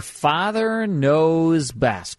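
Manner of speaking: drawn-out show title, very slow; announcer. Acted. Host announcing this hour's show, very slow. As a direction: stretch the title like a radio announcer.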